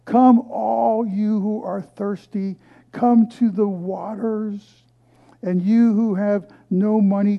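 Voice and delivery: high, loud, slow scripture call; slow, high, ringing scripture reading; preaching scripture. Acted. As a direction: proclaim it like a prophet calling out. Reads Isaiah in preaching voice.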